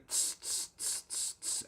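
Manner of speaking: a breathy unvoiced hi-hat hiss; beatbox hi-hat. Shown as a demonstration. Showing viewers the second looper channel, hi-hat sounds. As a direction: hiss out a steady cymbal beat. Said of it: "then you go".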